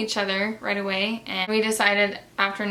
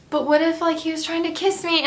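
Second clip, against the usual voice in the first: higher and louder, a sudden alarmed worry; mock panic; 8 semitones higher, 4 dB louder than usual. To herself after turning her head, a mock panicked realization. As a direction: let the worry jump up in a rush.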